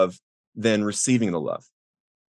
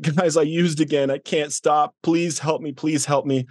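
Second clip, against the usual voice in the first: higher-pitched pleading, mildly desperate; retold tearful plea; 5 semitones higher than usual. Interrupting the prayer group to beg for help, retold pleading. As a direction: imitate a desperate plea without real tears. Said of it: "I'm crying. I'm a mess".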